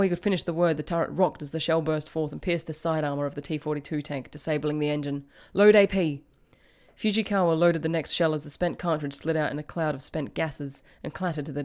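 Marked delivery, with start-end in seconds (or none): 5.5-6.2 loud, raised-pitch command; brisk battle order; shouted order. A narrator's reading. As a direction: shout it to the loader. Commander orders loader mid-battle.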